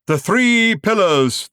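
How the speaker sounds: booming, slow and high proclamation; thundering. A narrator's reading. Showman thunders the pillars' names to the audience. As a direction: thunder it to the crowd. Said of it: "thundered the man".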